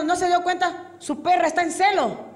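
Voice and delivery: high, insistent officer; mock exasperated questioning; annoyed policeman. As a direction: play a policeman pointing out the obvious. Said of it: "pasó un policía y se enojó".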